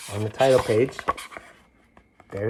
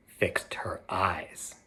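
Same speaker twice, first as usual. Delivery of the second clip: hushed storyteller whisper; soft dramatic whisper; 4 semitones lower, 9 dB quieter, much slower than usual. A narrator's reading. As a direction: breathe it like a secret. Storyteller hushes a key detail.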